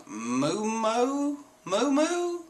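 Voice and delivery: high, drawn-out coo of a name; calling out. Retold or imitated. Nami hailing the sea beast, high drawn-out call.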